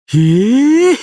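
high, rising exclamation of surprise; loud, laughing amazement; amazed. Acted. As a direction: burst out astonished, pitched right up. Character amazed at the discovery.

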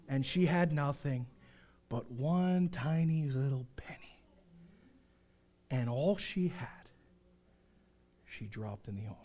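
low, soft, slowing to near silence; hushed storytelling. A narrator's reading. Preacher telling of the widow's penny, hushed. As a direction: let it fade tenderly, phrase by phrase.

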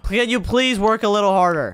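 projected plea. Acted. Boss urging workers on request, projecting loudly. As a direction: call it out for all to hear. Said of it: "Say it so the whole band can hear you".

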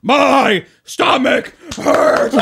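slow, loud, high rally cry; rally shout. Pretend. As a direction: bellow it to the crowd like a candidate. Mock rally, shouted slogan.

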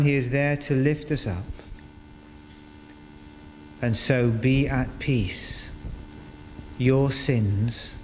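ordinary talk

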